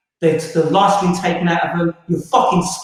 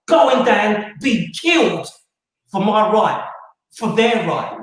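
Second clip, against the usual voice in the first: ordinary delivery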